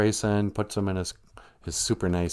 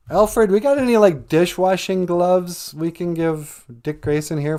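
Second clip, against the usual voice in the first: high, loud call to the butler; 9 semitones higher, 6 dB louder than usual. Acted. Bruce Wayne calls Alfred for dishwashing gloves, hollering high and loud. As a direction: holler it across to someone off to the side.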